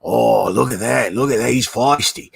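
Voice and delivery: lightly voiced delighted showman; mock wonder at a snapping snake; imitating Steve Irwin. Irwin admiring the snake that snapped at him, mild mock delight. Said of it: "he'd, like, you know".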